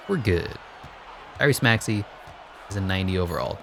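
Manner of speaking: ordinary talk, nothing marked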